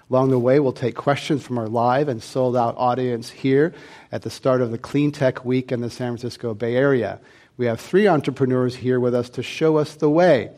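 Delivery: normal talking voice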